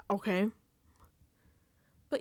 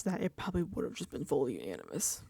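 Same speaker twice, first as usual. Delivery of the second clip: ordinary talk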